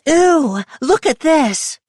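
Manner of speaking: put-on high, loud child's disgusted call; loud little girl. A narrator's reading. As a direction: call it out loudly like a grossed-out kid. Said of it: "Charlotte loudly narrated her discoveries".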